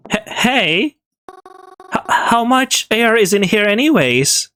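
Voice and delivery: scared and stammering; panicked. Acted. Trapped, panic rising.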